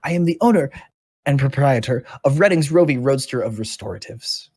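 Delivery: very high, loud chirpy gnome salesman; gnome shopkeeper. Acted. Gnome introduces himself to the party, high and chirpy. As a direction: gush like a flustered little shopkeeper. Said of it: "this gnome looks at you and goes".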